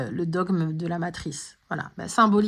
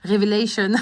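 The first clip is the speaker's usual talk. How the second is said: révélation said the English way, "Révélation" as "revelashon"; playful mock English accent on "Révélation", the French word anglicised; 4 dB louder than usual. Astrology vlogger jokingly says "Révélation" in a mock English accent. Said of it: "j'essaye de faire l'accent anglais là".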